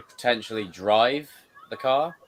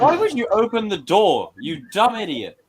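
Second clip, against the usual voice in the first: high, loud outburst at a fool; exasperated scolding; 8 semitones higher, 5 dB louder than usual. Real, not acted. Viewer berating the film character for opening the door, exasperated. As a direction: scold loudly in disbelief.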